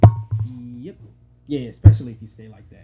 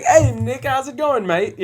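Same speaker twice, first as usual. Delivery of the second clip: higher-pitched British greeting; mock British accent; 10 semitones higher, slower than usual. Host imitating how Brits talk to a friend, mock British accent. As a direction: put on a chummy British accent. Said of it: "when they talk, are you, could you have a conversation".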